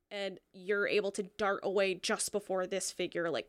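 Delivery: ordinary delivery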